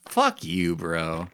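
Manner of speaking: ordinary delivery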